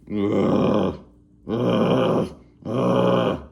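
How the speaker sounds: drawn-out groans of frustration; frustrated groans. Retold or imitated. Speaker groaning at his own slow speech progress. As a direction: groan it out, fed up. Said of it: "groaning at own slow progress".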